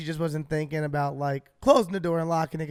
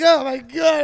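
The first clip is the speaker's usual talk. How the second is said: much higher, much louder yell out of the car; panicked yell; 9 semitones higher, 9 dB louder, slower than usual. Retold or imitated. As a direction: shout it out the window in panic. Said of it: "I'm yelling out to the car".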